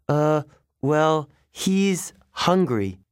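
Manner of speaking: slow, hesitant, trailing into bad news; frightened satyr breaking bad news slowly; hesitant dread. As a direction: drag out the last words with dread. Grover hesitates delivering bad news.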